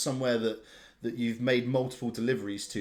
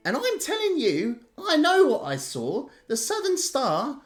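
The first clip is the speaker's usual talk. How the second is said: much higher, louder woman's voice, standing firm; insistent client woman; 14 semitones higher, 5 dB louder than usual. Acted. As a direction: play the stubborn customer, pitched way up. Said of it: "a woman who is clearly, a client".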